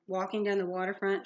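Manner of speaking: ordinary delivery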